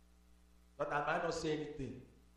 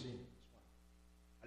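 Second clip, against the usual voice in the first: whisper; 23 dB quieter than usual. Real, not acted. Whispered pointing aside.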